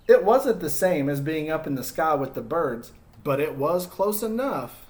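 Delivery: put-on storytelling to kids as Humpty, lively and expressive; story narration. Teacher reading Humpty's story to children, expressive narration. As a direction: read it like a picture book to a class. Reads picture book to children.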